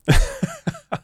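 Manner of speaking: a sudden shrill burst of laughter; high squeaky laugh. Real, not acted. Scoffing at the clerk's tiny discount with a shrill laugh. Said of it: "tak to jsem teda se zasmál".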